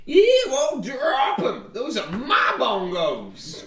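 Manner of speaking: slow, drawn-out mad villain cry; maniacal yell. Acted. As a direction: rant like an unhinged bandit. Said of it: "he yells maniacally".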